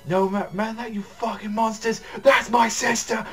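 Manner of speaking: high desperate shout; anguished protective cry. Retold or imitated. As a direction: throw yourself in front of her. Brother shields sister from blast.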